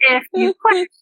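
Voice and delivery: hammed-up lecturing spouse; mock husband lecture. Husband scolding his wife about quitting, high mock lecture. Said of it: "He's like".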